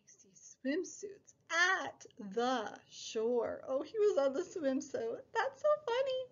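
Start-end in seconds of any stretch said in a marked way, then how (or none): none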